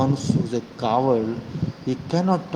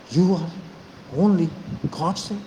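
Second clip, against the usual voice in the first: emphatic accusation; 5 semitones higher, slower than usual. Real, not acted. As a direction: spit each word with contempt. Branding opponents Godse.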